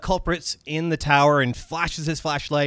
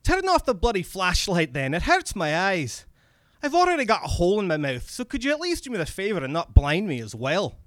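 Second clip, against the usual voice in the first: higher, irritated Scottish voice; Scottish accent; 5 semitones higher than usual. Acted. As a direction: grumble and plead in a Scottish accent. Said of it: "my bad Scottish accent comes in now".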